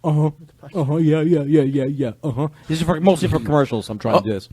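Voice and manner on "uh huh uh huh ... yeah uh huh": uh-huh guy. Pretend. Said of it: "He's the uh-huh guy".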